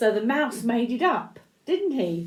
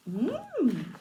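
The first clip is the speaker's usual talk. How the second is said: plain reading voice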